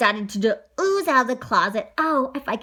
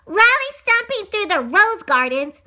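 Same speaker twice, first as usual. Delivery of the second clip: high, loud tattletale yell; 8 semitones higher, 6 dB louder than usual. A narrator's reading. Little sister tells on brother in the garden, loud yell. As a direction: shout the complaint across to Mom. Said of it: "Georgia yells".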